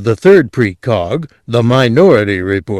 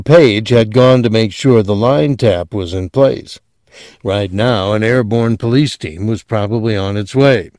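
usual speaking voice

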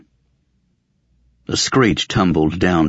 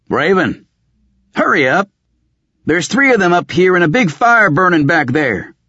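high-pitched, raised-voice yell to someone below; urgent call; 12 semitones higher, 4 dB louder than usual. Acted. Shouting down to Raven that fire is burning, urgent high call.

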